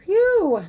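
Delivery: a big high exhale of relief; loud relieved phew. Real, not acted. Streamer relieved after fixing the misspelling, loud phew. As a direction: blow out relief loudly.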